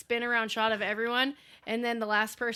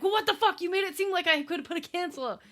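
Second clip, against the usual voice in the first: high exasperated outburst; 7 semitones higher than usual. Retold or imitated. Angry quoted outburst.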